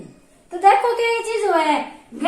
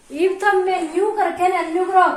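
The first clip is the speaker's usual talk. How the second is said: ordinary talk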